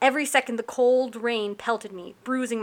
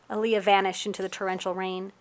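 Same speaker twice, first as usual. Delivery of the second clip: plain reading voice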